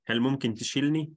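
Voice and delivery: Egyptian dialect تشيلني, quick and casual; egyptian accent. Shown as a demonstration. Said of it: "in Egyptian dialect, we use تشيل".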